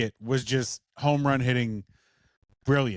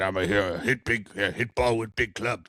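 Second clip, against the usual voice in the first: lightly lowered dumb caveman talk; a hint of a grunting caveman, lower; 6 semitones lower than usual. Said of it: "looks a little like a caveman".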